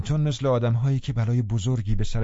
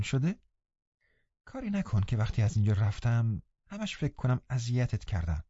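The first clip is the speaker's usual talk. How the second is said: put-on softer voice of a pleading girl; apologetic Catherine; 4 semitones higher, 4 dB quieter than usual. A narrator's reading. Catherine begging hurt Linton's pardon, softly pleading. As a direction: gently plead for forgiveness, lightly voiced.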